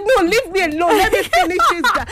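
a schoolgirl brushing off her friends, playing it up; retold defiant outburst. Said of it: "I said".

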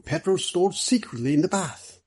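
high-pitched excited deduction. Acted. Middleton announcing his theory of the bath, excited. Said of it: "exclaimed Mister Middleton excitedly".

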